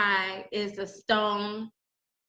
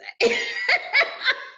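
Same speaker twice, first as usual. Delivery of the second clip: sudden high squeal of a laugh. Real, not acted. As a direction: burst out laughing at your own joke. Laughs at own joke.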